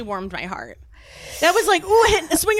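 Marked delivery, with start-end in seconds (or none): none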